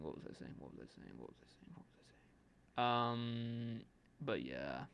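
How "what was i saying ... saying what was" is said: fading to a whisper. Real, not acted. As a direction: trail off into a whisper. Repeating to himself, fading.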